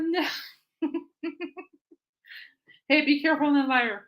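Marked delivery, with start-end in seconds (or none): none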